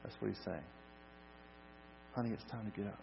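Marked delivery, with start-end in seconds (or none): 2.2-3.0 soft, tender coaxing; gentle waking. Acted. Jesus to the girl as to a napping child, gently. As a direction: wake a sleeper without startling them. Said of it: "real gently".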